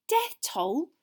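high, voiced question with rising end; alarmed whisper. A narrator's reading. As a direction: echo it in startled alarm, as a stage whisper. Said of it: "Hermione whispered, looking alarmed".

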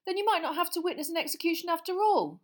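put-on high, firm boy's voice; stoutly. Boy defying the minister, stout. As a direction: stand up to him. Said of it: "said Ron stoutly".

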